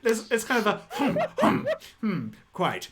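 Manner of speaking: high, laughing hums; amused walrus. Acted. Walrus amused by the bluff, laughing hums. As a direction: chuckle through the hums.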